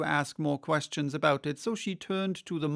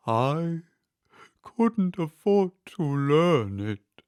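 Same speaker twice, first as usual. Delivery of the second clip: with a sigh; 3 semitones higher, 7 dB louder, much slower than usual. A narrator's reading. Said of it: "said the Mock Turtle with a sigh".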